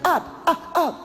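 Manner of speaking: high-pitched, short mocking cries; mock frantic commands. Mimicking those who keep shouting at demons, high mock cries. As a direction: imitate a clueless exorcist in a high voice.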